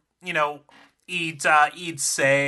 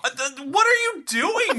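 incredulous; 13 semitones higher than usual. Real, not acted. Reacting to ketchup suggestion.

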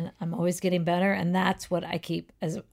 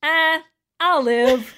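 dazed olive; 6 semitones higher, 6 dB louder, slower than usual. Acted. Punchline by dazed olive Joe.

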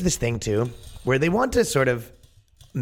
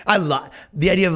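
laughing; 9 semitones higher than usual. Real, not acted. Laughing at the absurd idea.

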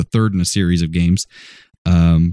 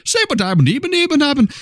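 much higher, slurred Scottish voice; thick Scottish accent. Retold or imitated. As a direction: mimic a drunk Scot mumbling. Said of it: "he was just like".

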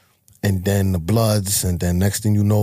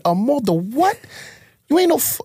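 indignant disbelief. Retold or imitated. Father confronting son about gang.

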